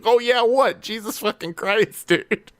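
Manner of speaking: high incredulous echo of "Oh, yeah"; mock shock at a casual brag; echoing the player in disbelief; stunned disbelief. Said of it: "I was like".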